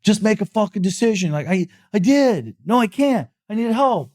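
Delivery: high, whining back-and-forth between spouses; mock whiny spouse quarrel. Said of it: "your spouse is saying it".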